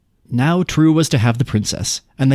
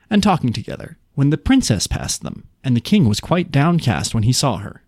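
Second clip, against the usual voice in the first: plain reading voice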